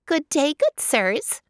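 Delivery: high, slow hare voice; lively high-pitched hare hello; hare greeting. A narrator's reading. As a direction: greet them in a bright little hare voice. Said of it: "she said".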